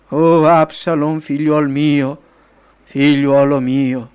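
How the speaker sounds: slow, loud wail of a bereaved father, mildly acted; grieving lament. A narrator's reading. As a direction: draw out each cry for the dead son. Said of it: "e pianse". (lamento di padre, lento e straziato)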